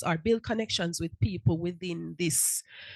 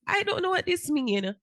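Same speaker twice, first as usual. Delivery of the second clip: confused; 9 semitones higher than usual. Retold or imitated. Repeated confused quote.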